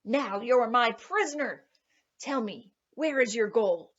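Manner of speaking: loud triumphant cry; cried out. A narrator's reading. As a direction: crow it out as you grab him. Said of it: "grabbed the sly fellow and cried".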